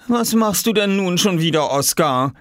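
high-pitched, exasperated scold; scolding mother. Acted. Exhausted mother comes into children's room, scolding. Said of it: "schimpfte sie".

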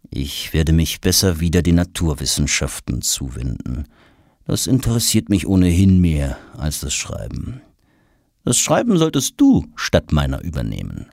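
subdued, downcast poet; a touch of heavy-hearted resignation; melancholy Goethe. A narrator's reading. Goethe hands the writing over to Tom, melancholy. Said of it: "lässt Goethe die Feder schwermütig sinken".

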